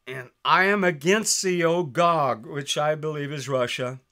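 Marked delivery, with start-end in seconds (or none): 0.1-2.5 raised, louder preaching read of the Bible; scripture reading. Acted. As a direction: proclaim the verses like a preacher.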